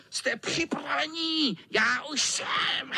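high squeal into a cackle; high wicked-cat cackle; gloating tomcat. Acted. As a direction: announce it gleefully, stretching the end. Cat readies to pounce, cackles.

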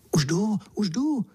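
high, keen calling out; eager call. A narrator's reading. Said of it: "zvolal jsem horlivě". (horlivé zvolání)

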